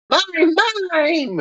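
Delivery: high squeaky Pokemon cry; Mr. Mime voice. Retold or imitated. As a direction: chirp the name like a little Pokemon. Mister Mime voiced in story.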